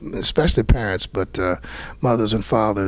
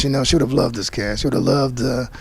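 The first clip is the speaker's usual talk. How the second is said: usual speaking voice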